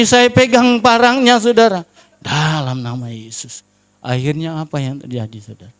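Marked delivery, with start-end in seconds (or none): 2.2-3.6 low, soft invocation; a silent prayer voiced quietly; inward prayer. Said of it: "saya dalam hati saya". (doa lirih dalam hati)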